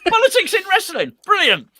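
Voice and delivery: high, loud laughing delight. Real, not acted. Presenter cracking up at the co-host's politics joke, laughing loudly.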